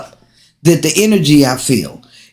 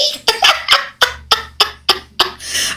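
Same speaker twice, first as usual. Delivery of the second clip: airy high-pitched giggle; high breathy laugh; 5 dB quieter than usual. Real, not acted. Laughing at her own voice.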